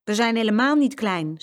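loud, high indignant retort; offended little-boy protest; angry. A narrator's reading. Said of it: "zei Jeroen boos".

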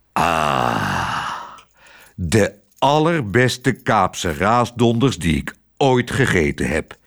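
long low "ah", then slow relish; savouring delight. A narrator's reading. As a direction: savour it like a blissful gourmet. Admiral savours the dish. (genietend, langzaam en vol smaak)

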